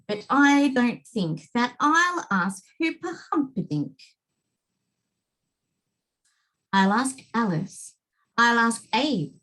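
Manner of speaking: put-on lively, bouncing children's rhyme; rhyming read-aloud. A narrator's reading. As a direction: read it like a bouncy picture book. Child narrator reading rhyming book.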